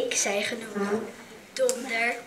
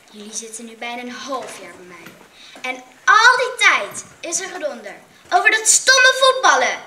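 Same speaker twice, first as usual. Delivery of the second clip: teacher's scolding rising to loud; stern teacher; 8 dB louder than usual. A narrator's reading. Juf scolding her class, voice rising angry.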